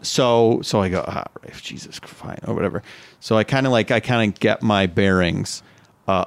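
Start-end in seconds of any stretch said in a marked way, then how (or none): 1.1-2.8 exasperated whisper under the breath; whispered exasperation. Retold or imitated. To Rafe, fed up, an exasperated whisper. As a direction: hiss it out, fed up. Retold muttered reply to Rafe.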